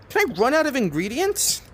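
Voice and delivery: sudden alarm; high-pitched panicked question. Real, not acted. Alarmed at running out.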